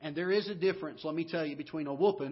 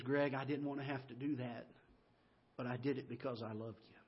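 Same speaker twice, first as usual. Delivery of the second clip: much softer, loving and gentle; tender shepherd voice; 3 semitones lower, 6 dB quieter than usual. Retold or imitated. The shepherd tells Greg he chastened out of love, tenderly. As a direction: speak it softly like a loving father.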